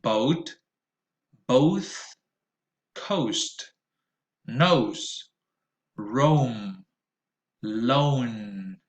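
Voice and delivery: put-on "Oat", "old", "ocean" said slowly with a clear rounded O diphthong; model words, repeat after me. Shown as a demonstration. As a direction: read each word slowly for students to repeat. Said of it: "O in the middle of words".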